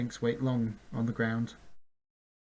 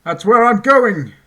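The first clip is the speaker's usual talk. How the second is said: loud, slow, higher call; called back; 10 semitones higher, 17 dB louder than usual. A narrator's reading. Chester answering Bink while galloping, a loud call. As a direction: call back over the shoulder. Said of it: "Chester called back".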